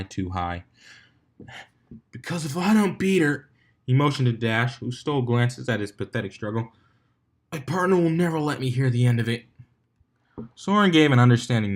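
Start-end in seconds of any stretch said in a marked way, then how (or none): 2.1-3.5 strained stallion, higher; Dumbbell's voice. A narrator's reading. Dumbbell explains while struggling to fly. 7.5-9.5 strained stallion, higher; Dumbbell's voice. A narrator's reading. Dumbbell explains while struggling to fly.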